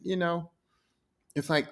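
ordinary talk

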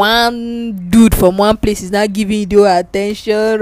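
ordinary delivery

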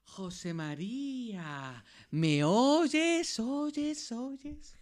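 slow hushed name, then a high call echoing away into silence; ghostly echoing call. Acted. A mystic radio voice calls José María, echoing and fading. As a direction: call him from beyond, letting the words echo and fade. Una voz se coló en el salón.

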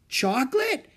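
high, exclaimed chocolate?; boy exclaiming in shock. A narrator's reading. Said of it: "exclaimed Henry Green".

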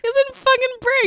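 high excited outburst. Real, not acted. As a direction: burst out with delight.

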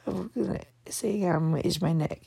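hushed, voiceless whisper; whispered aside. Real, not acted. Host confiding in her listeners, whispered aside. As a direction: drop to a secretive whisper.

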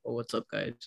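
low, soft, deep greeting. Shown as a demonstration. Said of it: "I feel like I'm even deeper".

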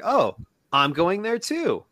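very high-pitched mock enthusiasm; retold eager suitor. Suitor invites himself on her errand, mock eager. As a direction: jump at any excuse to come along, hammed-up.

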